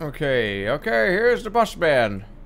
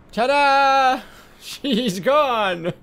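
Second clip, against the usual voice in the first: higher, louder showman reveal; triumphant ta-da; 9 semitones higher, 3 dB louder, much slower than usual. Real, not acted. Gamer showing viewers the erased lady, triumphant reveal. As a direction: present the trick like a magician.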